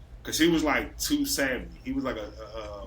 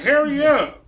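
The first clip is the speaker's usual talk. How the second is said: higher, louder snap of hurry up; impatient yell; 10 semitones higher, 10 dB louder, slower than usual. Retold or imitated. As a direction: rush a slow stoned friend. Coworkers rushing slow money count.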